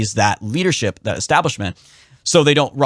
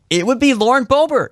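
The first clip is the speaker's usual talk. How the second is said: higher, landing hard on "Lauren Boebert"; dramatic reveal; 12 semitones higher than usual. Real, not acted. Host answering his own question, a dramatic reveal. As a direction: announce the answer with weight.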